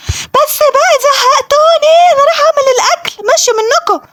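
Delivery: put-on high-pitched mother fed up with the kids; exasperated mom voice. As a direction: play the mom throwing up her hands and leaving. Mother voice storming off.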